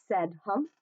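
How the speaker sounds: ordinary delivery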